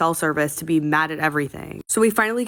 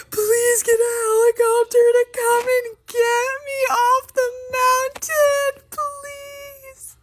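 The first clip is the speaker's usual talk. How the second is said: very high, squeaky hammed-up sobbing; mock sobbing plea; slower than usual. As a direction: imitate tearfully begging mom on the phone, dragging out the last words. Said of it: "I'm sobbing on the phone".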